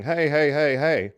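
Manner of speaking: loud, high repeated hey as he runs up; retold yelling. Boss yells at the workers as he runs up, loud. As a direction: call out while running toward the workers. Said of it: "toward us yelling".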